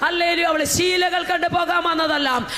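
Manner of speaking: fiery preaching. Real, not acted. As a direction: build each line like a rallying cry. Preaching at full force.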